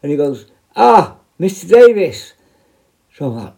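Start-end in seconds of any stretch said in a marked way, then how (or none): 0.7-2.3 loud, high, stretched-out hail; drawn-out greeting. Retold or imitated. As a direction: imitate someone hailing an officer slowly and loudly. Acted greeting from the prisoner.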